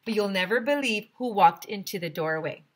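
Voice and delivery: ordinary delivery, nothing marked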